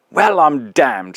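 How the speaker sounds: a gruff ghost, slow and stunned; astonished. Acted. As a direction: drag it out in shock. Ghost astonished at old employee.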